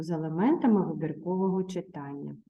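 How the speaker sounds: usual speaking voice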